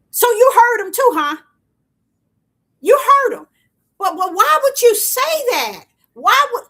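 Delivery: high, loud, eager questions; excited. Real, not acted. Preacher quizzing a listener who heard it, excited. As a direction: press them, thrilled they caught it.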